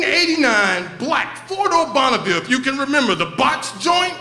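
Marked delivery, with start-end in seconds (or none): none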